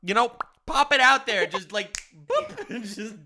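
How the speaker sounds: high, loud laughing through words; giggling while telling it. Real, not acted.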